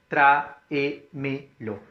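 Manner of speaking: TRÁEMELO split into tra-e-me-lo; tráemelo slowly, one syllable at a time. Shown as a demonstration. Teacher showing the class the word with enclitics, syllable by syllable.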